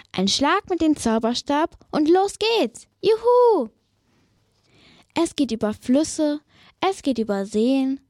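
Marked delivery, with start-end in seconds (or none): 3.0-3.7 high, loud whoop of joy; cheering. Acted. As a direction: cheer as the broom takes off. Witch cheering on takeoff.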